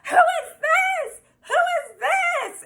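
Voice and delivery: very high, drawn-out screech; mock screaming. Imitating the girl who bursts in on them, mock screaming. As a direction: imitate a jealous ex shrieking, stretching each word. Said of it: "screaming at him".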